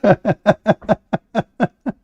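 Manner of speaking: bursts of high mocking laughter. Real, not acted. Debater laughing at his opponent's verse, mocking cackle. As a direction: laugh at him, high and delighted.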